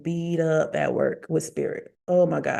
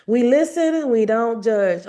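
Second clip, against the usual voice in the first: mocking trend quote; 5 semitones higher, 5 dB louder than usual. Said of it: "a new TikTok thing".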